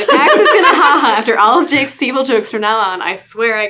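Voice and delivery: fed up but cracking up; giving up while laughing; laughing exasperation. A narrator's reading. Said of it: "I quit," laughing".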